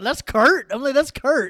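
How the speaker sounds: loud, high-pitched disbelief; surprised recognition. Retold or imitated. Fan reliving spotting Kurt on stage, high loud surprise. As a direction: blurt it out as if just spotting someone.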